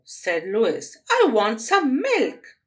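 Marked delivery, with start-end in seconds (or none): 1.1-2.6 put-on loud, higher bratty girl; petulant demand. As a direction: whine it like a spoilt child.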